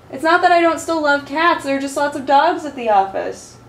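high, sweet voice to the cat; pet talk. Real, not acted. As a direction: coo at the pet. Talking to her cat.

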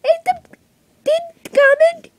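rising yell. Pretend. Kid yells a sign-off plea.

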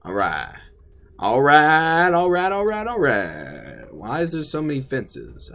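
usual speaking voice

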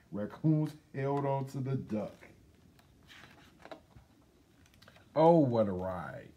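lively storybook read-aloud; reading to children. Acted. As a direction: read it to the kids with a build on every until. Reading a picture book aloud.

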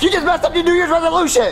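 high, worked-up reprimand; high, indignant parental outburst; exasperated scolding. Acted. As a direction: scold the child in disbelief. Dad scolds child.

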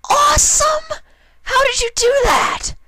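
very high, loud excited filly voice; thrilled squeal. Acted. Rainbow Dash cheering Applejack's trick, very high and loud. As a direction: burst out with delight.

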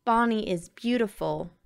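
plain reading voice

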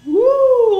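high startled whoop; excited whoop. Real, not acted. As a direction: yelp with surprise. Near miss in flight sim.

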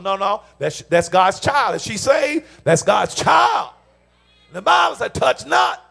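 loud, hammered sermon declaration; forceful preaching. Real, not acted. Preacher defending a wife as God's child, forceful preaching. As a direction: proclaim it loudly, leaning on each phrase.

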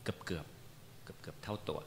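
slow, soft whisper; breathy whispered repeat; whispered aside. Real, not acted. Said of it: "เกือบๆเท่าตัว ถูกไหมครับ".

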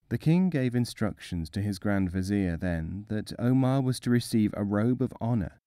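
ordinary delivery

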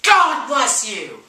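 slow, loud call of bless you; loud and drawn-out bless you. Real, not acted. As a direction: call it out loud across the room. Answering a loud sneeze.

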